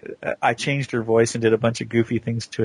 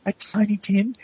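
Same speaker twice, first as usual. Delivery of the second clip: lightly imitating a little girl, higher pitch; sweet little voice; 8 semitones higher than usual. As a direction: attempt a sweet English child's voice. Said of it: "I can't even do her voice".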